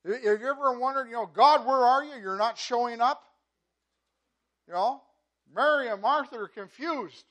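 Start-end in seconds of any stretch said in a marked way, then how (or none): 1.3-3.2 loud, higher plea asking where God is; exasperated cry to God. Retold or imitated. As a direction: cry out as if God has abandoned you. Voicing a doubter crying to God.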